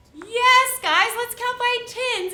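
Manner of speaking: loud, high yes; big, high teacher yes; excited yes. Real, not acted. Teacher confirms the class's answer.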